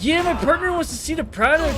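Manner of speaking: squeaky, overdone fake Mexican accent, playing a buyer; Jonah Hill's fake Mexican. Imitating Jonah Hill posing as a buyer, in a high, hammed-up fake Mexican accent. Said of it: "Jonah Hill ... when he pretends to be a Mexican".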